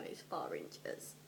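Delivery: deep slow mocking jab. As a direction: drop the voice low and slow to mock.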